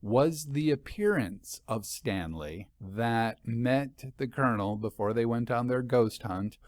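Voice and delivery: plain reading voice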